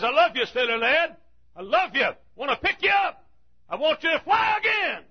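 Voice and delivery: high, rising to a shout of love; voice of God calling. Acted. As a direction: call the lost sailor home. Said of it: "God says".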